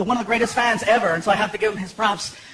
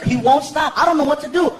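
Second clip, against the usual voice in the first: usual speaking voice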